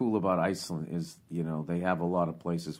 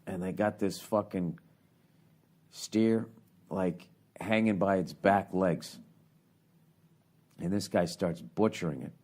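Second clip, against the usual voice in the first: normal talking voice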